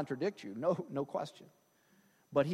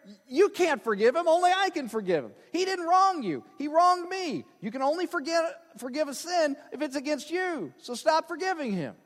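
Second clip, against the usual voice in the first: indignant Dick; 7 semitones higher, 4 dB louder than usual. Acted. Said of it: "Dick's gonna say".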